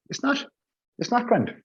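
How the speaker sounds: very high-pitched squeak of protest; high, indignant contradiction; incredulous objection. Acted. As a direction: object in exasperated disbelief, voice shooting right up. Arguing back at retold announcement.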